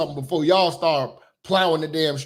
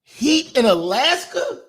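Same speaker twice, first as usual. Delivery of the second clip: slow incredulous laugh; laughing disbelief; 7 semitones higher, slower than usual. Real, not acted. Incredulous about Alaska heating.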